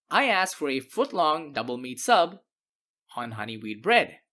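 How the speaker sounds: ordinary delivery, nothing marked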